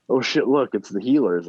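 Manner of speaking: loud, high, eager shout; excited call. Acted. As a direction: call out, thrilled to see them. Waving at the ambulance.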